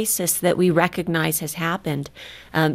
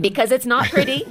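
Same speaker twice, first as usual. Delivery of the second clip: a laughing, raised aside; 6 semitones higher, 3 dB louder than usual. Real, not acted. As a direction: laugh through the joke about not looking pretty. Joking about ugly crying.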